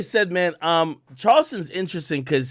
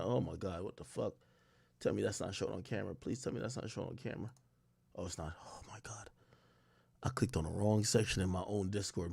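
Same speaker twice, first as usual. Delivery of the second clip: much softer, fast, hushed and rattled; hushed panic; 9 semitones lower, 18 dB quieter than usual. Real, not acted. Streamer panicking to chat about the camera, in a hushed, rattled voice. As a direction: mutter it low like you hope the mic misses it. Clicked wrong Discord section on stream.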